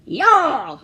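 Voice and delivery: loud high yell; battle cry. Acted. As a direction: shout it like a hero in training. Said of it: "He said".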